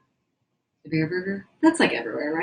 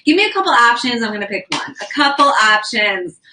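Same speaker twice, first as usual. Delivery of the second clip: playfully bossy, raised and punchy; demanding diva; 4 semitones higher, 6 dB louder than usual. Pretend. Hungry streamer asking viewers for food options, demanding diva. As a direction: make demands like a mock diva. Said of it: "This is me being a demanding diva".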